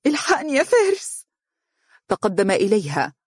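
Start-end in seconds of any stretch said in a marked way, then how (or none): 0.1-1.2 high-pitched frightened plea; terrified. A narrator's reading. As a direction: beg him for help in high-voiced fright. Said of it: "وهي تقول بفزع". (بفزع)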